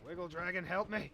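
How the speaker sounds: loud, high cry for help; shouted plea. Real, not acted. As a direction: yell out to an ally for rescue. Calls dragon for help in game.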